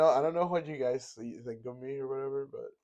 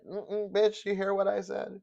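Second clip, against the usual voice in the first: a hint of Cat Williams, higher, sassy; Cat Williams impression; 9 semitones higher, 5 dB louder than usual. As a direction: mimic the clip's street swagger. Imitating Cat Williams clip.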